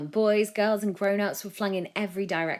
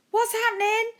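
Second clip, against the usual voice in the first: much higher, louder alarmed yell; 7 dB louder than usual. A narrator's reading. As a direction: shout it over the chaos. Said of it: "A J yelled".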